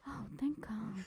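whispered echo of relief. Pretend. Friend echoing the other's relief, whispered. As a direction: breathe it out in a soft whisper.

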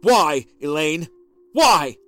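loud, slow trembling demand; barely holding back rage; husband trembling with fury; restrained fury. A narrator's reading.

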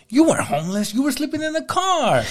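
mocking commenter. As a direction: pipe up like a smug know-it-all. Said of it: "someone in the comments said".

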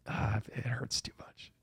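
whispered, slow and pained; a hurt whisper, slow. Retold or imitated. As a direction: breathe it out like it hurts. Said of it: "I'm just like".

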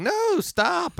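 high, drawn-out mock yell; imitated family yelling. Family warning the driver from the yard, mock yelling. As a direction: imitate panicked shouts from across the yard. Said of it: "whole family was in the yard yelling".

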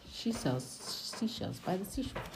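tongue twister. Shown as a demonstration. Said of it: "I just had to say that".

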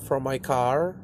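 ordinary talk, nothing marked

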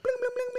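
high squeaky bling bling; very high cartoon bling chant; mock bling noise. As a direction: squeal it like a silly sound effect. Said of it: "Man went".

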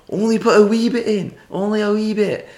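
high Scottish pleading; mock an insistent Scot, pitched high; Scottish accent. Scottish customer instructing the bartender, high Scottish accent. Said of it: "these Scottish fellas came up".

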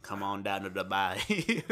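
hammed-up slow Southern drawl. Teasing friend about Preacher accent, mock Southern drawl. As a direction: stretch it out in a mock Southern twang. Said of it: "a Southern drawl".

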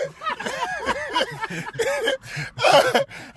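long high cackle at your own joke; real delighted cackle; high gleeful laugh. Real, not acted.

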